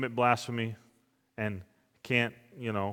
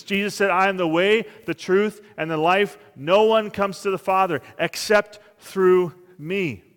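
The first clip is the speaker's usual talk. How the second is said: louder, higher, driving sermon voice; full-force preaching; 8 semitones higher, 10 dB louder than usual. Acted. Pastor preaching salvation to the congregation at full force. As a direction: build it up and hammer the message.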